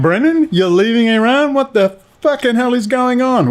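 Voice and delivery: high, mocking viewer voice; imagined viewer. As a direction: squeak it out like a baffled fan. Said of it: "You're gonna be going".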